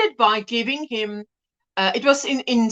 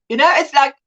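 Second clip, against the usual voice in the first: ordinary delivery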